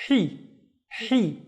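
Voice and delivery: "hi" as a short, out-of-breath "hee"; breathy Arabic ha. Shown as a demonstration. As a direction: push out the h in "hi" as if winded. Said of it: "the guy running out of breath".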